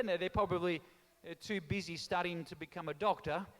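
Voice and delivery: ordinary talk, nothing marked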